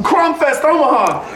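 high, big promo call; hype announcer shout. Acted. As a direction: announce it like a show poster.

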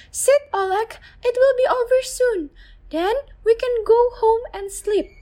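high, gentle mother's voice; soothing mother. Acted. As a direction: hush a frightened child. Mother comforts son in shelter.

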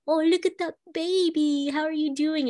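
put-on high sing-song voice for a baby; sing-songy parentese. Shown as a demonstration. Parent talking to a baby, high sing-songy voice. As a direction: coo it up high like greeting a baby. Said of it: "more of a sing-songy voice".

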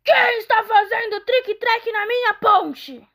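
loud, high roaring troll. Acted. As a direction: roar it from under the bridge. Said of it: "o troll rugiu".

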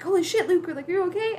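high, loud acted astonishment; startled relief. Friends seeing Luke pop up out of nowhere, mock astonishment. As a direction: exclaim as if suddenly startled.

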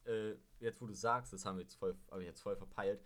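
ordinary talk

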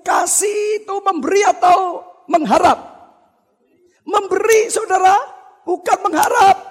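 high, loud fervent sermon build; preacher urging the congregation to give, preaching hard; preaching at full force. Acted. Preacher rebukes couples who only want to get, preaching fervently.

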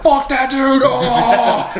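very high, hammed-up crowd outrage; mock angry yell. Friends riled up about the intruder, mock yelling.

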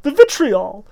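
very high, loud panicked babble; terrified babble. A narrator's reading. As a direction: blurt it out in terror. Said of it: "terrified he began pouring out".

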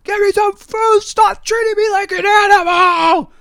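very high, drawn-out mock scream; hammed-up screechy rant. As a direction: imitate a man wailing in protest across the street. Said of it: "just screaming about how".

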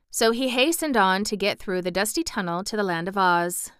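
plain reading voice

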